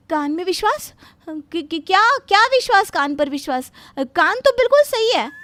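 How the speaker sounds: high, loud, stammering protest; flustered alarm. Acted. Bride mishears talk of her ears, flustered and defensive. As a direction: blurt it out high, tripping over the first words.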